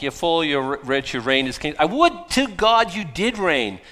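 mock admiration building to a loud, high wish; hammed-up sarcastic gushing. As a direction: lay the fake praise on thick, rising. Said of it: "he's being sarcastic".

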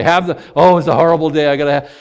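loud, frantic plea for drinks; frazzled demand. Pretend. Stressed husband phoning his wife for martinis, loud and frantic. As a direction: call ahead desperate for martinis. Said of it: "I call her".

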